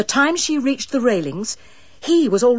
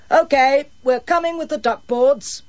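self-important; 7 semitones higher, 4 dB louder than usual. A narrator's reading. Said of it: "a self-important voice announced".

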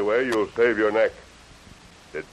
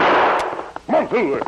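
loud urgent call to a horse; 7 semitones higher, 4 dB louder, much slower than usual. Acted. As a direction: shout it out to spur the horse on. Rider urging horse to gallop.

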